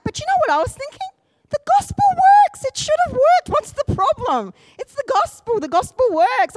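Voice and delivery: very high, loud, incredulous insistence; worked-up retelling of inner frustration; exasperated protest. Retold or imitated. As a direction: protest as if it simply must work.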